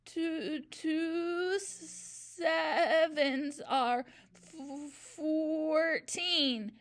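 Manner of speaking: gasped. A narrator's reading. Dangling boy giving in, gasping and crying. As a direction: choke it out through tears. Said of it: "gasped Rupert".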